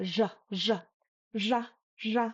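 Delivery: ja like the S in pleasure; French-J ja. Shown as a demonstration. Teacher demonstrates Central Tibetan pronunciation, ja with French J. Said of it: "or the J in French".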